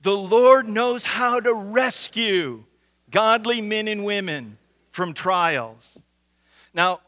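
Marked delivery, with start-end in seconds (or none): 0.0-6.0 slow, loud congregational recital with "rescue" emphasized; stress on rescue. Shown as a demonstration. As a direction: say it all together, slow and loud, punching "rescue". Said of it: "One more time, let's say it".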